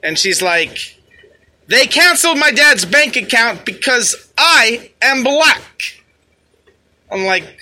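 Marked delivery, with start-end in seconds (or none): 1.7-5.6 high, slow, loud mock outrage; imitated irate protester. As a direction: wail the grievance like an indignant protester. Imitates the angry protesting woman.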